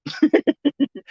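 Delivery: high-pitched giggle; real laugh. Real, not acted. Amused at wanting cats on the team, high giggle. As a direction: burst into a short, high laugh.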